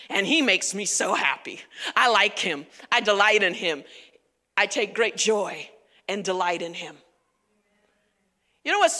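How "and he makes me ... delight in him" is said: low, tender fatherly voice; tender delight. Acted. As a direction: lower your voice and cherish him. God voiced tenderly delighting in son.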